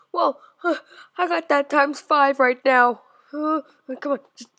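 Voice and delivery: ordinary talk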